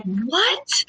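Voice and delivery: high-pitched rising, incredulous "what"; horrified disbelief. Retold or imitated. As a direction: reenact appalled shock in one word. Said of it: "I'm horrified".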